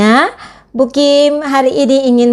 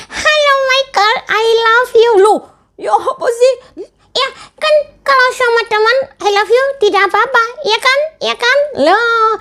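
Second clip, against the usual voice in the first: put-on much higher childlike puppet voice; puppet friend; 13 semitones higher than usual. Teacher voicing her puppet for the children, high puppet voice. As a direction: play the cheeky puppet sidekick, pitched way up. Puppet says I love you.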